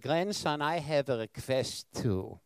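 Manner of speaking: put-on slow old-country Polish accent, lightly done; Polish grandma accent. Polish accent, slow and gentle. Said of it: "in her accent from that true old country".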